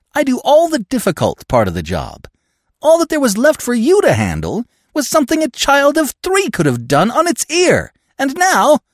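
high, loud contemptuous scolding; contemptuous tirade. Acted. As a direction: berate the fool. Peavy berating accomplice.

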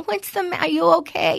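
high-pitched mock worried mom; fussing mommy. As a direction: coo like an overprotective mommy checking on her kid. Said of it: "her mommy to come over and go".

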